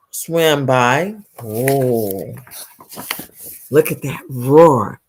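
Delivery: usual speaking voice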